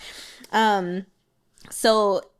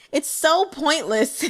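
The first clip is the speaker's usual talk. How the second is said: laughing; 6 semitones higher, slower than usual. Real, not acted. Amused by the characters' antics.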